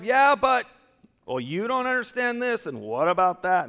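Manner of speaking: high, loud protesting excuse voice; whiny yeah-but objection. Retold or imitated. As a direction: play the stubborn believer arguing back with God. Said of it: "Stop getting the "yeah buts".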